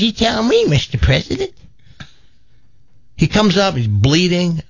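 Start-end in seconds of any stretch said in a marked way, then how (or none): 0.0-1.5 high, put-on old lady voice, defiant; an eighty-eight-year-old demanding her place; feisty old granny. Granny telling the Hells Angels she wants in, in a high put-on old voice. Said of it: "She says".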